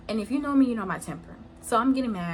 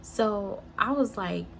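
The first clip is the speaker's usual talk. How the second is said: usual speaking voice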